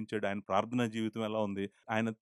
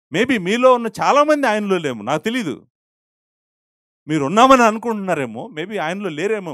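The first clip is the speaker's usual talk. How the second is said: raised, loud pleading with the church; forceful challenge; 7 semitones higher, 11 dB louder than usual. Real, not acted. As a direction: call them out boldly. Preacher challenging the congregation. (గట్టిగా హెచ్చరిస్తూ)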